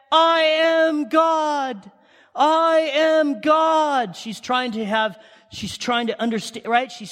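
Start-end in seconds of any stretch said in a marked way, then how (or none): 0.1-4.1 Shirley MacLaine screaming. Retold or imitated. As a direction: scream it to the sunset like a self-declared deity. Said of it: "screaming at the top of her lungs".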